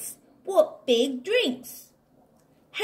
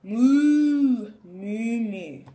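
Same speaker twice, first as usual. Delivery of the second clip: lowing moos that fade away; cow mooing; 4 dB louder, much slower than usual. Acted. Reading to a child as the cow, fading moos. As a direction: moo like a sleepy cow. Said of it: "I'm a cow and I say".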